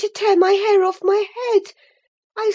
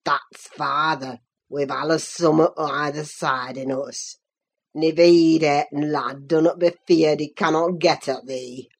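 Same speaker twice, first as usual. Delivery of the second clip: low voiced old servant; crying out, old Joseph. A narrator's reading. Joseph gloating.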